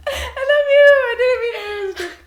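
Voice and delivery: high, loud fake sob; mock crying. Girl pretending to cry an apology to her friend, hammed-up sobbing.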